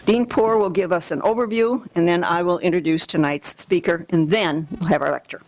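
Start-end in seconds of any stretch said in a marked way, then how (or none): none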